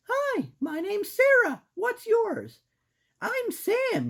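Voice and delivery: put-on squeaky girl mouse; high-pitched cartoon mouse. Voicing mouse characters.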